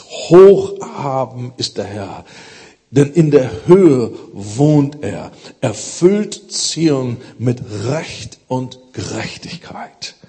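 slow, loud proclaiming of the verse; solemn scripture reading. Acted. Preacher reading Isaiah aloud to the congregation, solemn and slow. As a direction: lift the words up like a preacher declaring God's height.

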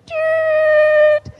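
very high, loud siren toot; imitating a heavenly alarm siren; siren beep. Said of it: "so eine kleine Sirene an".

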